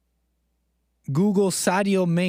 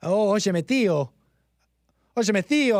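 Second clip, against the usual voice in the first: put-on lightly done Castilian Spanish accent greeting an uncle; 2 semitones higher than usual. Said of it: "speak Spanish with that castellano".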